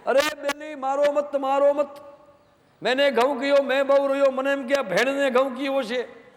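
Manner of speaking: a Kutchi man wailing over his ruined wheat, hammed up; Kutchi dialect lament. The Sandhi wails to the crying bride in Kutchi dialect. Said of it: "કચ્છની ભાષામાં".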